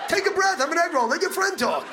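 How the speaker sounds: mock-exasperated shout. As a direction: yell it like a fed-up listener. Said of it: "comic yelling at imagined speaker".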